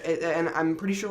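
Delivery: usual speaking voice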